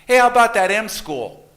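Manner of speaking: put-on high, loud four-year-old piping up from the back seat; excited kid asking about the M school; little boy's voice. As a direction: chirp it out like an eager small child. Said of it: "and he goes".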